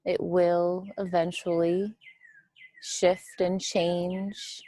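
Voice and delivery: measured meditation voice with long, spacious pauses; guided meditation. Real, not acted. Teacher guiding listeners to witness the breath, guided meditation. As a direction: guide them gently through the breath, leaving room between phrases.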